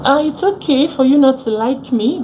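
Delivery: ordinary talk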